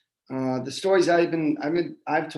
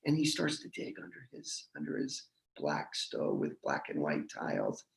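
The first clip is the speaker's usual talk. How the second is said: much softer hushed narration; quiet; 12 semitones lower, 11 dB quieter than usual. A narrator's reading. The narrator tells of digging at night, much softer and hushed. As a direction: hush it so the sleepers stay asleep. Digging while family sleeps.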